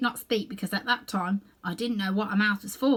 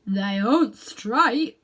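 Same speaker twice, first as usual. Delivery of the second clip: slow, deliberate critic; slow Munchkin voice; 6 dB louder, much slower than usual. A narrator's reading. Munchkin judges the ears, slow and lightly voiced. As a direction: drag it out, lightly voiced doubter. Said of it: "answered the other".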